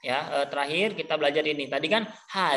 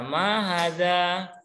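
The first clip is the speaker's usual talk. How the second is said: slow model; slower than usual. Shown as a demonstration. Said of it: "teacher models the new phrase".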